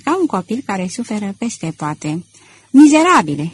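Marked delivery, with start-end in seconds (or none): 2.7-3.5 loud, higher burst of hatred; furious shout of hate, narrated; hateful outburst. Acted. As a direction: explode at the man who killed her. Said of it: "strigă el deodată într-o răbufnire de ură".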